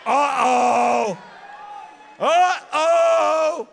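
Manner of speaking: loud, high drawn-out uh-oh; mock uh-oh. Real, not acted. Speaker teasing students before the clip, loud mock uh-oh. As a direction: tease the crowd with a big uh-oh.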